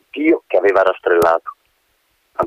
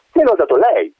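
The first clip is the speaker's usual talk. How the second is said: indignant cry; 9 semitones higher than usual. A narrator's reading. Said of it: "gridò Antonella".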